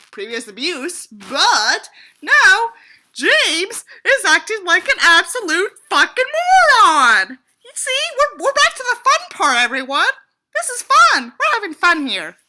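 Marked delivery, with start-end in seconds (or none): 1.1-7.4 louder, slow exasperated rant. Real, not acted. Ranting at the character. 7.6-12.4 higher, louder mock cheerful tone; sarcastic cheer. Real, not acted. Sarcastic about fun part.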